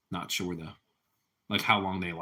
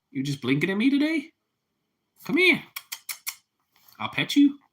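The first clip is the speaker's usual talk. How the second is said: pet coaxing voice; 10 semitones higher than usual. Real, not acted. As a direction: sweet-talk the pet in a high voice. Coaxing pet Louis to come.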